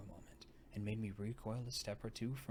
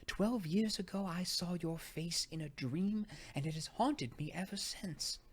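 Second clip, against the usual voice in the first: awed recollection; 8 semitones higher, 5 dB louder than usual. A narrator's reading. Guest confessing her dream to the narrator, high and awed. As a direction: tell the dream as if still astonished.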